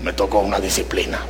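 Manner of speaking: put-on deep teen voice. Son calls his father on the phone, deep voice. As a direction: drop low like a thirteen-year-old. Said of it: "tiene la voz así muy profunda".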